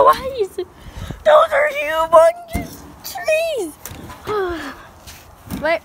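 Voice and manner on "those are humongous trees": stunned by the huge trees, calling out slowly; amazed shout. Real, not acted.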